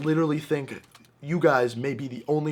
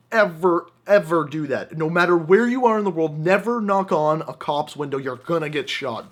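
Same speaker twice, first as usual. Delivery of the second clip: normal talking voice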